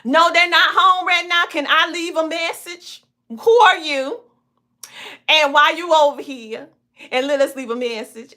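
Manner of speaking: nosy neighbor. Retold or imitated. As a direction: play the suspicious neighbor quizzing a stranger. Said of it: "point and say".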